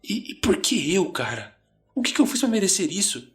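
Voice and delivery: high, pleading complaint; agitated why-me plea; distressed protest. A narrator's reading. Johnny pacing, panicked, complains.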